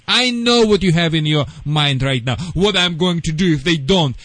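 ordinary delivery

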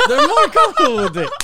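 high, fast laughing voice. Real, not acted. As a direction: squeal it through laughter. Cracking up at the joke.

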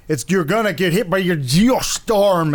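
put-on mushy Scottish accent, out the side of the mouth; exaggerated thick Scottish burr. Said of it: "he has a thick kinda Scottish accent".

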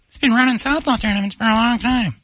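high falsetto wife voice; clueless mob wife. Acted. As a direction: chirp it as the unsuspecting wife.

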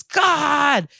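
high, drawn-out cry of pain; mock scream. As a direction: imitate a man screaming as he drops. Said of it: "falls over and screams".

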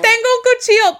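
high reenacted scream. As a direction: cry it out in sudden panic. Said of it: "I screamed".

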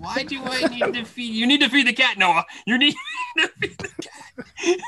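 a hint of a scolding mother, laughing through it; mock stern mom. Father imitating his wife ordering their groggy son, laughing. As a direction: ham up mom barking orders, cracking up by the end. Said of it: "totally yelled the junk out of him".

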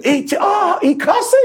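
驚いた現地の人を高い声で真似る; high, gasping mock shock at hearing a swear; mimicking shocked Australians.

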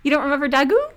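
put-on Daggoo in a Kiwi accent like Rhys Darby; New Zealand accent. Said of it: "I'm saying with a New Zealand accent".

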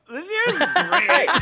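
high, loud, drawn-out sarcasm; laughing fake cheer; mock enthusiasm. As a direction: gush sarcastically, stretched and high. Sarcastic gripe about the year.

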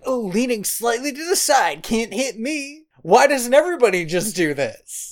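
hammed-up incredulous mockery; mock smug disbelief. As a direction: sneer it like a smug fool who thinks he's clever. Mocking characters' dodging logic.

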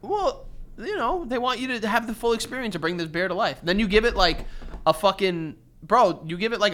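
usual speaking voice

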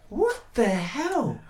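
high, drawn-out disbelief; slow stunned what the hell. Retold or imitated. Man retelling his reaction to her voicemail, slow stunned disbelief. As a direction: let it hang in shock. Sudden realisation.

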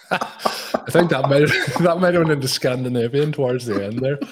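cracking up while talking; laughing. Real, not acted. Host laughing at impression.

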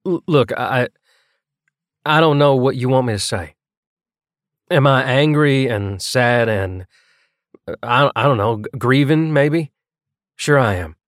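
halting, stammered confession; hesitant, broken-up phrases with false starts; stumbling over thoughts. A narrator's reading. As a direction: grope for each word, tripping on first sounds. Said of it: "he stumbled over his thoughts".

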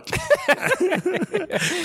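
high burst of laughter; real high-pitched laugh. Real, not acted. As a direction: crack up at the teasing. Banter teasing a player.

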